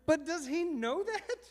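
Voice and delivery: high, slow, laughing disbelief; incredulous question. Retold or imitated. Husband asking wife about their son, high incredulous laugh. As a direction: ask it as if it's absurd.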